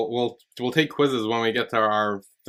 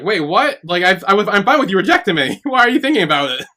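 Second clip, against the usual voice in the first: startled outburst; 7 semitones higher, 6 dB louder than usual. Retold or imitated. Aoki shocked at Ida's answer.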